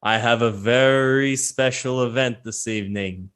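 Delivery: slow fight-night announcer; Spruce Tuffer. Acted. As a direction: announce the bout with drawn-out showmanship. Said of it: "Spruce Tuffer kinda walks out, and he's like".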